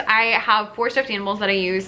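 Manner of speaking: ordinary delivery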